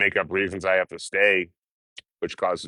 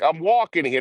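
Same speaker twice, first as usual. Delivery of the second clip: put-on New York accent; 10 semitones higher than usual. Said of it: "like the classic".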